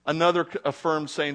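ordinary talk

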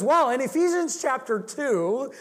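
high and loud announcing the chapter; emphatic Ironside. Retold or imitated. Ironside quoting Scripture.

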